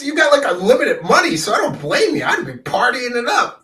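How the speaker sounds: laughing. Real, not acted. Host teasing the guest about his money, laughing. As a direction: chuckle through the jab about money.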